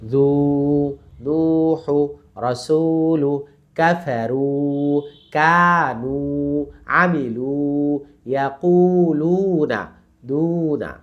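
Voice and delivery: slow word list with every long u sustained; recitation drill. Shown as a demonstration. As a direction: chant জু, নূহু, রাসূলু on held level notes. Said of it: "টোটালটা বলি আমরা".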